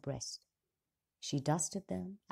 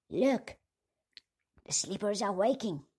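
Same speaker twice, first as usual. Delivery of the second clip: loud, higher alarmed shout; alarmed call; 5 semitones higher, 6 dB louder than usual. A narrator's reading. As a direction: cry out a warning. Dwarf cries out alarm.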